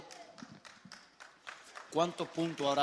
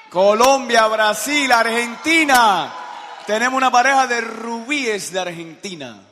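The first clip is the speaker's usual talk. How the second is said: loud, high announcing of each country to the room; booming roll call of countries; calling out to the crowd; 4 semitones higher, 12 dB louder than usual. Real, not acted.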